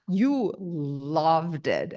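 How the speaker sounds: very slow, stretched-out retold reproach; drawn-out emphasis. Therapist telling client an uncomfortable truth, drawn out slowly. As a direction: stretch each word for effect, quoting someone.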